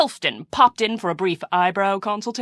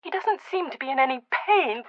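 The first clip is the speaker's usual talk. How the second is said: ordinary delivery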